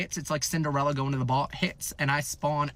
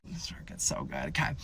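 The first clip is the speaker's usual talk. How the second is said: secret whisper; 6 dB quieter than usual. Real, not acted. Teasing the story's twist.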